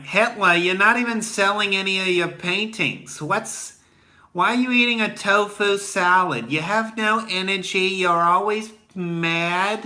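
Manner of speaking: mocking high voices scolding Hitler, slow at the end; nagging girls. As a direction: tease him like unimpressed girls. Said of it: "They were like".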